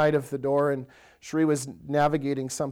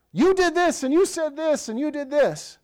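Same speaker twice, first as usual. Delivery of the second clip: mock accusing list; 10 semitones higher than usual. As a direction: rattle off grievances at a spouse. Listing a spouse's old faults.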